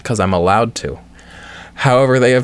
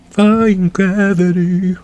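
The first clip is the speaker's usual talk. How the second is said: very slow, stretched words; slow drawn-out; 7 semitones higher, much slower than usual. Acted. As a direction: stretch it out slowly. Drawn-out character quip.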